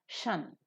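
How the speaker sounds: "shun" as one short syllable; suffix sound. Shown as a demonstration. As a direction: say "shun" as the ending sound of -tion. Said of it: "which is pronounced as".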